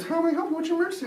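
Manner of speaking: high-pitched phone operator voice; lady operator. Acted. Emergency operator answers kid's call, high lady voice. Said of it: "The lady answers".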